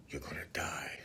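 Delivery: hushed, whispered death warning; eerie whisper. Retold or imitated. Woman warning him he's going to die, eerie whisper. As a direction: whisper it like an ominous prophecy. Retold death threat in spooky tone.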